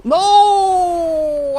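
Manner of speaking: high, loud cry of no; real dismayed shout; anguished no. Real, not acted. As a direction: wail it like you just lost. Loses the game.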